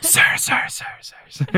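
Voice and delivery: whispered echo, repeating and fading away; imitated reverb echo. Host mocks the flashback's sound design, whispered fading echo. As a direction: mimic an over-the-top reverb trail in a whisper. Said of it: "the reverb is turned up to".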